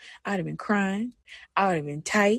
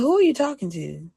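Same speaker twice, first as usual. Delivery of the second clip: indignant challenge; 3 semitones higher than usual. Retold or imitated. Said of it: "stop myself and be like".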